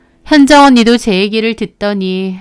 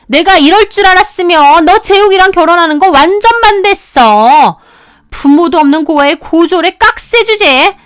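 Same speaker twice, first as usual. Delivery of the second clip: angry tirade; 5 semitones higher, 4 dB louder than usual. Acted. Sister-in-law scolding her brother's wife, furious yelling. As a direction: berate her like a furious elder.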